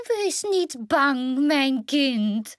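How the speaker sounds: hoog en traag, verleidend heksenstemmetje; heks moedigt aan. Acted. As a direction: lok haar langzaam over de streep. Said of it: "moedigde de heks aan". (trage hoge heksenstem die aanmoedigt)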